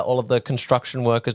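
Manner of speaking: ordinary delivery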